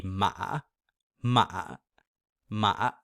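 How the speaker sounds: "ma" caught in the throat at the bottom of a dip; third tone dip. Shown as a demonstration. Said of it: "falling down, and then rising back up".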